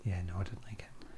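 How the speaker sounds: hushed whisper. Pretend. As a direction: breathe it out secretly. Creepy Weasel, repeated as whisper.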